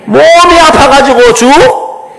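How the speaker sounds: slowly drawn, stretched 죽 at the end; slow demo. Shown as a demonstration. Preacher demonstrating the practice phrase slowly for the congregation. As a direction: draw the sentence out, hold 죽. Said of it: "제가 한번 해볼게요".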